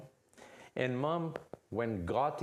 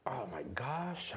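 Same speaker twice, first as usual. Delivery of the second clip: whispered, exasperated mom; 5 dB quieter than usual. Acted. Mom whispers her frustration as Junior leaves, exasperated. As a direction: mutter it under your breath in disbelief. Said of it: "the mom was like".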